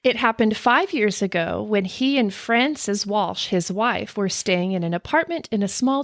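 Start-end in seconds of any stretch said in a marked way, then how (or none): none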